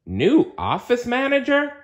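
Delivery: big surprised monster asking back. Acted. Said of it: "Sully said in surprise".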